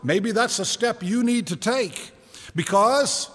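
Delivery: ordinary talk, nothing marked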